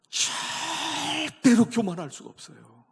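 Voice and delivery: high, loud, slow "절대로 교만할 수가 없어요"; a slow, full-force pulpit cry; preaching shout. Real, not acted. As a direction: thunder it out over the congregation. Preacher shouts after the whisper.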